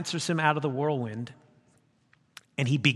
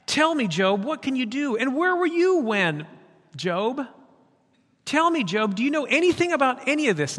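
higher, louder booming challenge; challenging God; 9 semitones higher, 5 dB louder than usual. Acted. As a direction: press Job with question after question. God voiced challenging Job.

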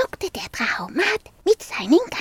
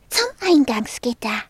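ordinary delivery, nothing marked

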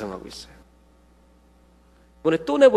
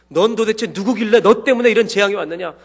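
higher, louder accusing demand; sailors demanding; 6 semitones higher, 5 dB louder than usual. Retold or imitated. Sailors confront Jonah over the storm, loud accusing demand. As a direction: throw the question at him like a frightened sailor. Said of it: "선원들이 마구 묻습니다".

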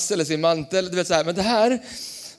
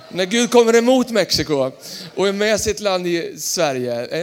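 ordinary talk

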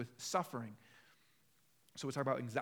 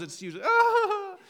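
high laughing whimper of women; mock a high wordless cry with a laugh; 13 dB louder than usual. Shown as a demonstration. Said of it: "I can either grunt or cry".

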